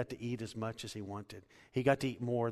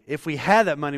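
much louder and higher on the repeat; building to a shout; 4 semitones higher, 13 dB louder than usual. Acted. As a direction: drive it home, nearly shouting. Preacher building repeated line.

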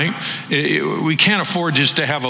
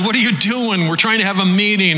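irritated meeting host; 7 semitones higher than usual. Retold or imitated. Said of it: "It's like".

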